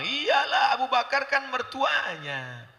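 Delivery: high, drawn-out sneer imitation; mocking gossipers. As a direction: mimic people saying "of course". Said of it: "apa kata orang?".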